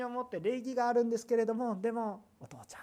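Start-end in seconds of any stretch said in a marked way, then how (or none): 2.4-2.8 whispered tender "お父ちゃん"; soft hushed call to daddy; intimate whisper. Retold or imitated. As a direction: breathe it like a child to father.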